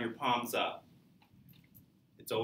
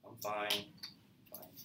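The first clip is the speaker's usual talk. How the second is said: flat unenthused; 2 semitones lower, 11 dB quieter than usual. Shown as a demonstration. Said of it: "when you answer that way".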